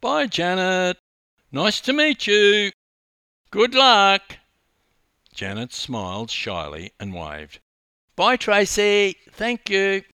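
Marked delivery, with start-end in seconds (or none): 0.0-4.4 loud, high farewell call; forced cheer. A narrator's reading. Swimmer waving over her shoulder to rival, forced cheer. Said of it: "forced a smile". 8.1-10.0 high, loud farewell call; calling goodbye. A narrator's reading. Young swimmer waving to her rival, calling out.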